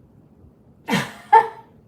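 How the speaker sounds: a loud, high, breathless burst of laughter; loud shocked laugh at the film. Real, not acted. Reacting to watching Avatar.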